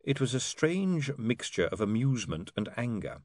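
ordinary delivery, nothing marked

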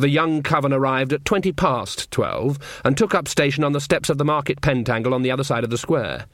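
ordinary delivery, nothing marked

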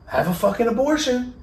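high, loud imitation of an angry dad; father's blunt outburst. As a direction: blurt it out as the outraged father. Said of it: "Just like that".

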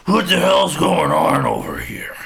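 high, loud angry holler; pissed-off supervisor. Acted. Supervisor storms in on the worker, pissed-off yell. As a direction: bellow it, outraged and loud. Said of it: "He's all pissed off".

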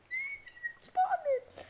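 high, slow squeal of delight; stretched-out joyful shriek; excited squeal. Retold or imitated. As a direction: squeal it like a fangirl. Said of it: "I'm like".

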